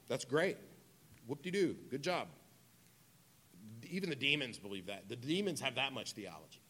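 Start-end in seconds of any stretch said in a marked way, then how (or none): 0.1-2.3 mildly mocking praise, pitched up; mock approval; sarcastic. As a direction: congratulate them with dry sarcasm. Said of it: "He's being sarcastic here".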